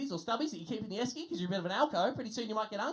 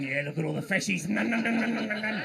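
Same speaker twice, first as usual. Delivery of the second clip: creepy guy; 5 dB louder than usual. Retold or imitated. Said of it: "Just the guy at the airport going".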